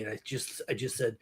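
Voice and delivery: ordinary talk, nothing marked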